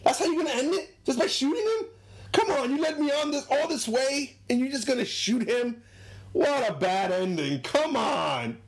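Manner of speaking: very high, incredulous complaining voice; mock outrage at a lousy ending; high-pitched whine of a let-down fan; disgusted old man. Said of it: "and the guy's like".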